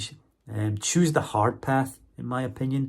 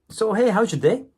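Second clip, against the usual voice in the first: high, loud, perky greeting; chatty shop assistant; 11 semitones higher, 6 dB louder than usual. Retold or imitated. Shop girl greeting a customer, chatty. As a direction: imitate a friendly cashier, pitched well up.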